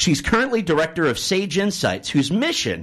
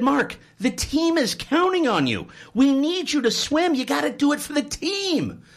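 higher, earnest pep talk; urging coach; 9 semitones higher than usual. Acted. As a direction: coach pleading with his swimmer to try. Coach appeals to team.

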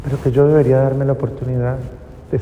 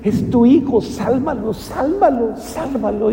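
tearful plea; 6 semitones higher than usual. Retold or imitated. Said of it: "lloraba y le decía".

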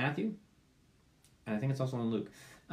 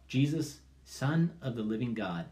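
prayer; 2 semitones higher than usual. Acted. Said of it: "cry out all the more".

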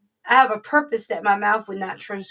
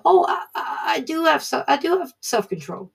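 higher, startled defensive protest; indignant protest; 4 semitones higher than usual. Retold or imitated. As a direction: insist you do have it, caught off guard. Protesting to God, retold.

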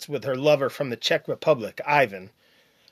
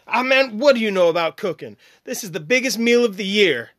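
higher, quick dismissive mom voice; scoffing mother; 6 semitones higher, 4 dB louder than usual. Acted. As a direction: brush him off impatiently.

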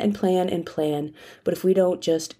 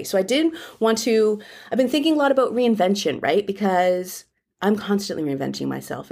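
ordinary talk

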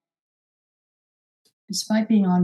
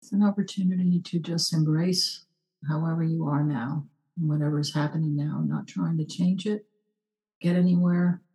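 guided meditation; 2 semitones lower, 3 dB quieter than usual. Acted. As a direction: hush down and guide them inward. Leads a short meditation.

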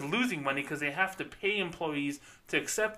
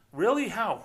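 higher, hammed-up surprised customer; mock amazement; 7 semitones higher, 3 dB louder than usual. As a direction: gasp as if amazed. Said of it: "That sounds like that fake voice".

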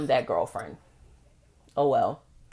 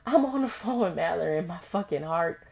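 usual speaking voice

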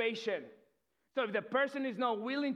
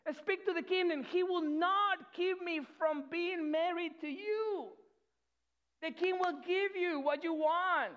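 slow, higher, earnest pleading; pleading sister; 6 semitones higher than usual. Retold or imitated. Tamar begging her brother, slow raised plea. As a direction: beg him slowly in a raised voice. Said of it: "she says".

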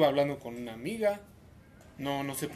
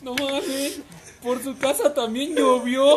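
mock idiot voice, much higher and louder, slow, laughing; mocking dumb friend; 11 semitones higher, 10 dB louder, much slower than usual. Mocking Daniel's dumb quake claim, hammed-up laughing. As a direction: ham it up like a clueless friend. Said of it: "el pendejo de Daniel".

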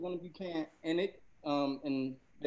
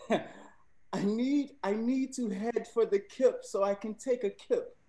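higher-pitched amateur British accent; attempted British accent; 5 semitones higher, slower than usual. Shown as a demonstration. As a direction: read it out in your best attempt at British. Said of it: "in a British accent".

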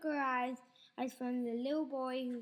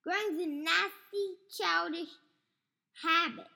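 put-on crabby old grandma; 3 semitones higher, 5 dB louder than usual. Grandma scolding George.

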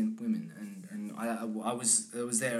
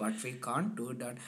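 slow Indian-accent mimicry; drawn-out imitation of an Indian accent; imitated Indian accent; 4 dB quieter, slower than usual. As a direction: echo the Indian speech rhythm slowly. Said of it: "I was just copying his rhythms".

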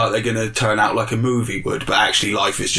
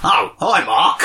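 loud, chirpy greeting; hammed-up movie greeting; mock Wiseau greeting; 3 semitones higher, 5 dB louder, slower than usual. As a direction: hail him in a cheery put-on voice. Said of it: "quoting The Room".

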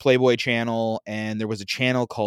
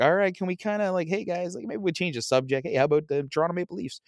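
awkward subject change; 7 semitones higher than usual. Retold or imitated. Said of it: "and I'm like".

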